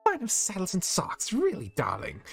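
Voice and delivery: high, prim scolding voice; refined disapproval; posh stern lady. Acted. Rarity scolding.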